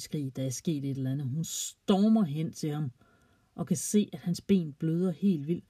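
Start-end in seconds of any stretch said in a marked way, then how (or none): none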